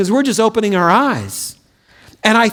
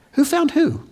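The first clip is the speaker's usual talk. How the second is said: playful question; 6 semitones higher than usual. Acted. Preacher poses playful question.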